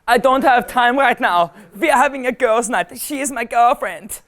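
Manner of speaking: high-pitched mock German girl; German girl accent. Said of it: "What do the German girls say?".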